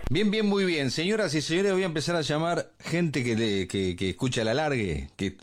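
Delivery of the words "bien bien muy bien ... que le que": usual speaking voice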